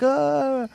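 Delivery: startled high yelp; high yelp of surprise. Retold or imitated. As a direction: yelp as the bottle flies at you. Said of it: "I'm like".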